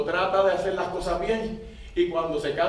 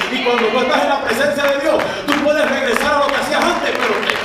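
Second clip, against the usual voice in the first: loud, higher, pressing sermon peak; preaching at full force; 4 semitones higher, 6 dB louder than usual. Acted. Pastor warns backsliders, loud higher preaching. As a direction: preach it over the crowd.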